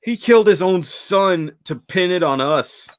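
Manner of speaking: high, slow, insistent mock rant. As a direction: hammer out the accusation like a raving man. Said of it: "goes even further into that rant".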